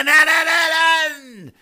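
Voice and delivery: high, loud revving noise; imitated engine revving. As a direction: mimic a bike engine screaming along.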